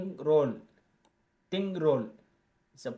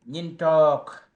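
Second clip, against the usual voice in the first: loud announcement; 4 semitones higher, 14 dB louder than usual. Shown as a demonstration. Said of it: "teacher introduces new Dinka word".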